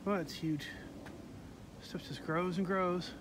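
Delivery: normal talking voice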